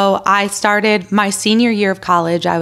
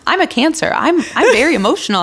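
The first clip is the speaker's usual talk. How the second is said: laughing self-mockery; 8 semitones higher, faster than usual. Retells herself, laughing.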